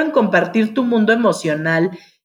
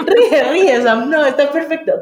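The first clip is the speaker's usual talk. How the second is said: higher, louder, laughing along; 6 semitones higher, 3 dB louder than usual. Real, not acted. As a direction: laugh with her and urge her on. Answers a laughing friend. (animando a Sam entre risas)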